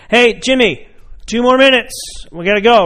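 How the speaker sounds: loud parent call across the park; calling the kid. Retold or imitated. Parent calling Jimmy off the playground, loud call.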